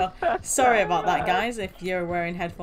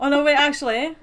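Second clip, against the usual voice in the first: alarmed; 5 semitones higher, 7 dB louder than usual. Real, not acted. Game freezes, alarmed.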